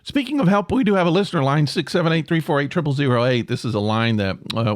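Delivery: normal talking voice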